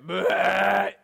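a hammed-up gagging sound; playful vomiting noise; mock retch. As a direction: fake a baby throwing up. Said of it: "And I go".

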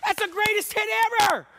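high, loud gushing cheer; proud parent cheer. Retold or imitated. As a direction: gush over the hit. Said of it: "you're like".

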